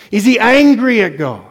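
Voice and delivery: usual speaking voice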